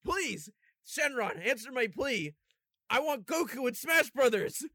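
high, loud pleading wish. Pretend. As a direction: beg the dragon dramatically. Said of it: "Sonic Fox is gonna be like".

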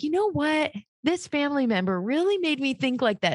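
high-pitched self-parody of indignation; mock blaming. Mimicking herself blaming a family member, mock indignant. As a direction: pitch it up like someone pinning the blame.

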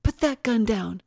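high, loud command; screamed order. A narrator's reading. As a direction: cry it out as an urgent order. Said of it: "Tara screamed".

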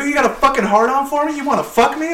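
high, rapid taunting questions; retold going off. Worker taunting his boss, high quick mockery. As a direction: goad him, quick and high. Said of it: "start going off on him".

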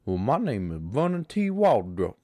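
lightly drawled Southern man introducing himself; a hint of the film character; old Southern accent. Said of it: "He's like".

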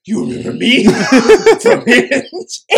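loud, high mock challenge breaking into laughter; exaggerated confrontation. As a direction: imitate his confrontation, blown up big and laughing. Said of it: "I didn't say it like that".

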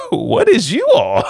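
high, slow disbelieving laugh through it; teen laughing at his sister's order, high and slow; incredulous, laughing. Retold or imitated. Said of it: "I'm looking at her like".

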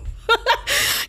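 a voiceless burst of laughter; breathy laugh. Real, not acted. Laughing at own joke.

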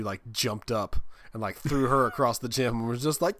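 ordinary talk

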